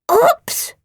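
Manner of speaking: high, loud cartoon monster growl; little monster caught out. A narrator's reading. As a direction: growl it guiltily. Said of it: "growled Phoebe".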